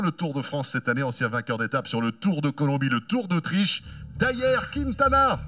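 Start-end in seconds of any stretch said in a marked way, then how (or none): 4.2-5.4 the name pitched higher and drawn out slowly; announcer name call. Real, not acted. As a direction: sing the name out to the crowd. Announcer presents rider to crowd.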